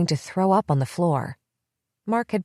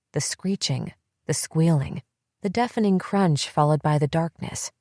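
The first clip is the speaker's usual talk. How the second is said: ordinary delivery, nothing marked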